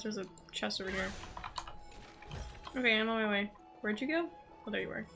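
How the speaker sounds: ordinary talk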